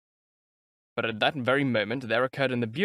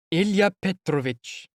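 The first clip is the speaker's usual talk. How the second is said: concerned clerk; 6 semitones higher, 3 dB louder, slower than usual. A narrator's reading. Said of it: "with a note of concern in his voice".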